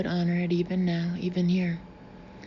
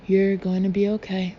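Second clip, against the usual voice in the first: ordinary delivery, nothing marked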